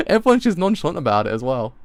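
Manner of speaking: laughing disbelief; real amusement. Real, not acted. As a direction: marvel at it while laughing. Comments while laughing.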